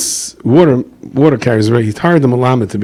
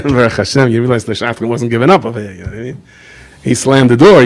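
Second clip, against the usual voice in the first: ordinary talk, nothing marked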